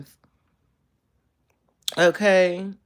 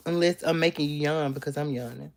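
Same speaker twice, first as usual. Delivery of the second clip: ordinary talk, nothing marked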